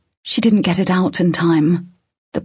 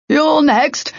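high, raised menacing call; called-out threat; 10 semitones higher than usual. A narrator's reading. Puppeteer threatening Tern as he fires, called out. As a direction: call the threat across the fight. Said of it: "he shot Tern a look and called".

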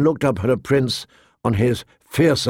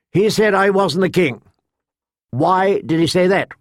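higher, pressing demand; lightly voiced challenging king; 7 semitones higher than usual. King Joffrey questions Sansa about her father, mildly demanding.

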